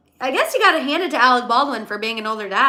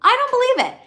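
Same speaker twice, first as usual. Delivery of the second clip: pitched way up in disbelief; incredulous; 12 semitones higher, faster than usual. Real, not acted. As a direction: refuse to believe it, voice jumping high. Incredulous about Hilaria's body.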